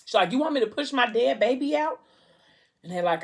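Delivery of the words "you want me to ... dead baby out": high-pitched incredulous question; acted-out shock, pitch jumping up; appalled disbelief. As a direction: question the doctor in horrified disbelief. Mother appalled at being told.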